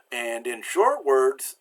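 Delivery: ordinary talk, nothing marked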